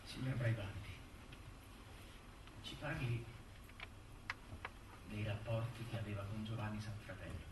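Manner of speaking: very soft, low, with long pauses; hushed questioning. Acted. Lawyer asks Braibanti about intimate relations, hushed and halting. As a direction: ease into the delicate question quietly.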